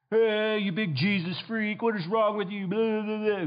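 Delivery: jeering mocker. Heckler jeers at a believer for following Jesus, mocking.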